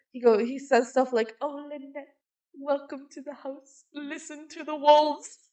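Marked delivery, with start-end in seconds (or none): 1.4-5.4 put-on hushed, high vampire accent, drawn out; Dimitri. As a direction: ham up a soft vampire accent. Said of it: "he really hams up the accent now".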